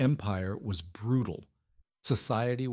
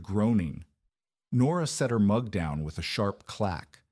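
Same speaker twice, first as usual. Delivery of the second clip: ordinary delivery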